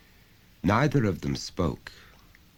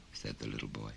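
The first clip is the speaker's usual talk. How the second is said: ordinary delivery, nothing marked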